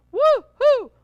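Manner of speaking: loud, high whoop; shrill-free big celebratory woo-hoo; cheer. Real, not acted. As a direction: whoop it up for the viewers. Cheering on live stream.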